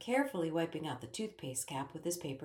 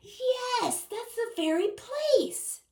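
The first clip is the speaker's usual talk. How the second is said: much higher, louder and slow; rather loudly; 6 dB louder, much slower than usual. A narrator's reading. Ellie distracts the king from the door, loud and much higher. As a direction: call it out to cover the moment. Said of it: "Ellie said rather loudly".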